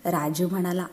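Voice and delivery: usual speaking voice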